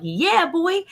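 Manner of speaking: high, hyped-up cheer; excited. Retold or imitated. Mom cheering over the bacon with her kids, excited. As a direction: whoop it, re-enacting the hype. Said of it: "I'm all excited".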